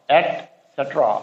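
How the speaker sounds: एटसेटरा spoken slowly, et-set-ra; slow model of etcetera. Shown as a demonstration. Teacher slowly modelling etcetera for students, et-set-ra. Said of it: "इसका pronunciation है".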